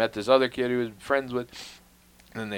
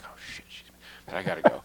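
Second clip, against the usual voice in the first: whispered inner panic; hushed worried aside; panicked whisper; 4 semitones lower, 5 dB quieter, faster than usual. Retold or imitated. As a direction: hiss it under your breath like a private thought.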